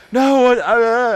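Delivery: loud, high spluttering protest; flustered customer. Retold or imitated. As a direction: imitate a man caught out, lost for words. Acting out flustered customer being thrown out.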